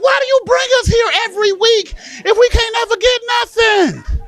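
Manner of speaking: high, loud protesting wail; exasperated shout. Acted. Child to grandmother about the weekly store trip, exasperated shout. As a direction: yell the complaint at a high pitch.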